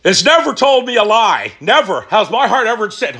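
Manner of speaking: ordinary talk, nothing marked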